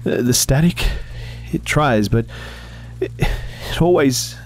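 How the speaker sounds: a lightly voiced boy, shaken and stammering; wavering voice. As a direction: let the voice waver out of control. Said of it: "his voice wavered wildly".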